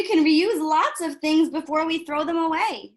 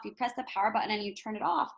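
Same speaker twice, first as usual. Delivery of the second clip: normal talking voice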